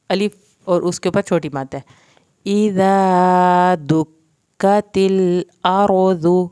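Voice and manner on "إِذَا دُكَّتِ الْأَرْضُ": slow, louder, drawn-out melodic tajweed recitation; Quran recitation. Shown as a demonstration. Teacher reciting the full verse for students, slow melodic recitation. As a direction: chant it out full and slow, stretching the long vowels.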